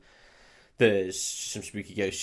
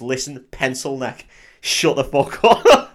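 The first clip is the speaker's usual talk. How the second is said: slow, loud, higher growled put-down; tough cop snarl; 4 semitones higher, 8 dB louder, slower than usual. Retold or imitated. Retelling the cop shutting up the EPA guy, loud and slow menace. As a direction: lean on every word like a hard-nosed cop.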